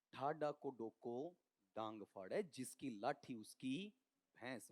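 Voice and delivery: slow, low Haryanvi proverb; Haryanvi saying. Shown as a demonstration. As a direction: recite it slowly in Haryanvi. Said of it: "बहुत बार बोलता हूं ना हरियाणवी". (हरियाणवी लहजे में धीमी कहावत)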